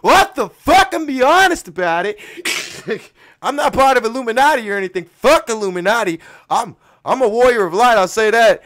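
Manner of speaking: high, loud, all-out yelling; shouted rant. Real, not acted. Host ranting to listeners about humans and Illuminati, shouted. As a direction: yell it at full volume, worked up.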